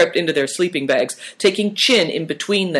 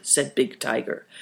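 ordinary delivery

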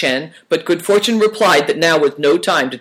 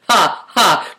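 higher-pitched, deliberate ha-ha; spoken laugh; 5 semitones higher, 4 dB louder than usual. A narrator's reading. Uncle Chen, a little drunk, teasing the chatterers, laughing. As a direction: laugh it out as two words. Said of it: "Uncle Chen laughed".

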